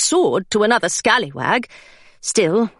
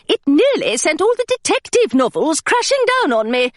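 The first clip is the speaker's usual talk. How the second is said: governess; 6 semitones higher than usual. Acted. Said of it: "she said".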